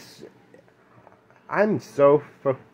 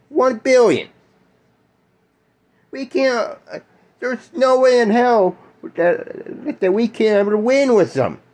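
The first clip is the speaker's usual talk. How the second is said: angry outburst; 9 semitones higher than usual. Real, not acted. Furious rant about China and war.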